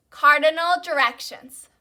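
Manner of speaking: loud, measured word for repeating; vocabulary call-out. Real, not acted. Teacher has class repeat academic vocabulary, loud call-out.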